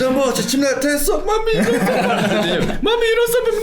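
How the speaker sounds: high, loud laughing mommy voice; coaxing mother. Retold or imitated. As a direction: coax like a doting mom. Mimicking a mother coaxing child.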